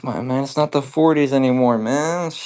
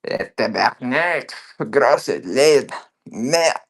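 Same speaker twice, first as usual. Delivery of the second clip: put-on Quebec French accent; much slower than usual. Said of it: "tabarnak is French Canadian as fuck".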